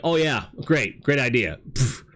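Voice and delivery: mock-sarcastic scoff ending in a pfft; ridiculing. Imitating a friend who ridiculed a rational commenter, scoffing. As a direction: imitate a dismissive playground sneer. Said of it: "ridiculed them".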